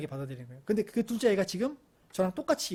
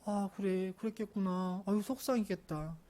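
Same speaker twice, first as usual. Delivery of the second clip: slow, soft, tender empathy; hushed, drawn-out sympathy; soothing father; 5 dB quieter than usual. Acted. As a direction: comfort a hurt child gently. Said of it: "큰아이에게 했던 것처럼 둘째 아이에게 똑같이 해봤어요".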